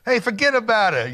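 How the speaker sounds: a playful Brooklyn-style fuggedaboutit; mock New York accent. Host teasing guest about his accent, mock New York accent. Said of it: "I also love your New York accent".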